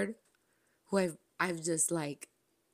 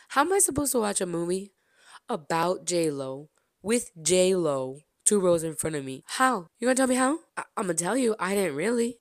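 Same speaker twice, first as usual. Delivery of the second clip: louder, fast, near-shouting rant; excited loud rant; 2 semitones higher, 7 dB louder than usual. Real, not acted. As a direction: rant at your friends, can't believe it. Ranting about watching near J-Lo.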